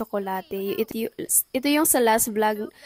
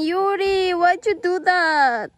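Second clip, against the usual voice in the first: slow, higher plaintive complaint; hammed-up whine, louder; mock whining; 7 semitones higher, 4 dB louder, slower than usual. Mother whining to Yuri about her plants, mock sad. Scolding the child playfully.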